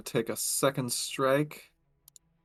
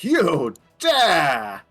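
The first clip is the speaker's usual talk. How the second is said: loud, outraged in-character battle cry; Victor shouting; 2 semitones higher, 9 dB louder than usual. Acted. Player voices Victor challenging the attacker, shouting. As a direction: shout it out as the character, drawing out each word.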